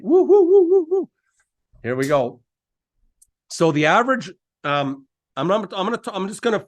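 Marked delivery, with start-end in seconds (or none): 0.0-1.1 high, loud whooping cheer; hype whoops. Real, not acted. Host whooping to fire up his audience. Hyping the crowd, fired up.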